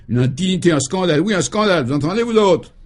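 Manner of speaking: high, loud, indignant outburst; vociferating. A narrator's reading. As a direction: rail at the whole room. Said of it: "vociférait-il".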